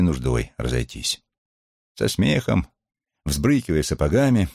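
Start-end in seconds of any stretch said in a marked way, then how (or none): none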